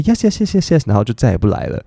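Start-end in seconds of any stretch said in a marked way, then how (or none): 0.0-0.8 much higher rapid polite yeses; polite British customer. Retold or imitated. British diner politely dodging the waiter's question, high and breezy. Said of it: "然后英国人就会".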